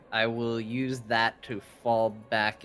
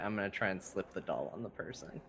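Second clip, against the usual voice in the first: normal talking voice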